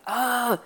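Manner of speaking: high groan of frustration; mock-exasperated groan; exasperated ugh. Locked-out owner hears there is no ladder, retold exasperated groan.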